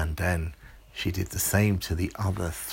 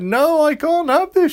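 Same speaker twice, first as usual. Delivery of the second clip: much higher and louder, squeaky protest; squealing monster; 12 dB louder than usual. Acted. Grape monster being tickled, squealing high and loud.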